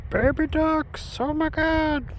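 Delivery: real high-pitched delight; delighted cooing. Real, not acted. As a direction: coo over them in a high, thrilled voice. Cooing at baby ducks.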